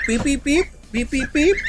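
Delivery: high, rising beeps like a chick; imitated peeps. Real, not acted. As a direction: peep back at the gosling up high. Imitating gosling peeps for the baby.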